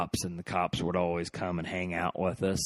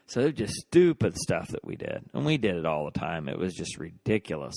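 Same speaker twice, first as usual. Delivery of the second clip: ordinary delivery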